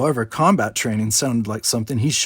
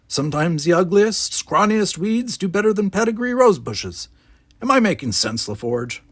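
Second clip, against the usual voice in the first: put-on grumpy old man; 8 semitones higher than usual. Gardener musing.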